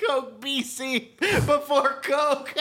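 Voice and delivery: slow, laughing high. Real, not acted. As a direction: say it through laughter. Laughing joke.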